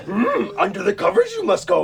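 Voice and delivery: put-on high, slow Yoda with a Dutch accent. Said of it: "with a Dutch accent".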